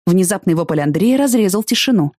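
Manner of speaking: plain reading voice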